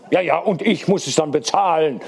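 understated angry outburst. A narrator's reading. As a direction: grumble indignantly without really shouting. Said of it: "schrie Friemann zornig".

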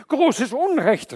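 loud, high, slowed outcry; outraged cry. A narrator's reading. The cornered man cries out at the snake, loud and high. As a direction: shout the injustice. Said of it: "rief er".

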